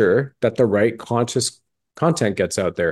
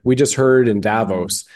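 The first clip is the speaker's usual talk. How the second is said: ordinary talk, nothing marked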